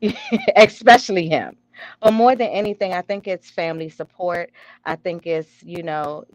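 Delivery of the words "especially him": amused, louder laugh into the words; laugh, then tease a friend; laughing as you single him out; laughing agreement. Real, not acted.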